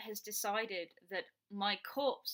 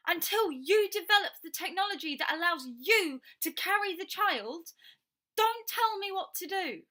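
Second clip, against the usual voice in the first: loud, higher, furious and insistent; angry ranting; 7 semitones higher, 8 dB louder than usual. Real, not acted. Demanding her right to choose, loud furious shouting. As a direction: hammer every demand home, shouting. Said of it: "he can tell that I'm getting angry and upset".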